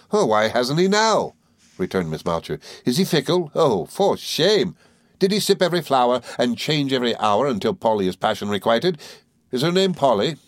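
high, quick, chattering little woman; Miss Mowcher voice. Acted. Mowcher chattering to the boys, high and merry. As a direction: rattle on like a gossiping dwarf.

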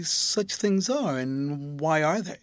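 slow, stretched-out musing. A narrator's reading. Rosa nodding slowly over such things, musing. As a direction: draw it out as if pondering. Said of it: "as who should say".

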